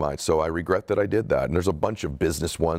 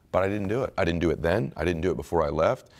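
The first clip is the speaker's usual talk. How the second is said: normal talking voice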